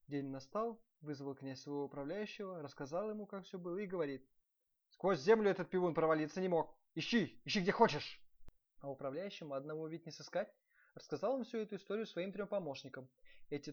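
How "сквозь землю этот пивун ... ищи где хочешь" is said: loud, higher princely order; angry command. A narrator's reading. Prince sending his steward to search, angry loud command.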